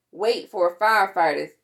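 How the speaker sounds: usual speaking voice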